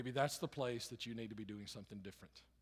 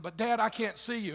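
frightened cry; 8 semitones higher, 10 dB louder than usual. Acted. Boy cries from burning window.

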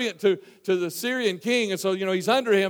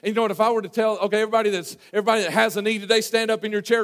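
ordinary talk, nothing marked

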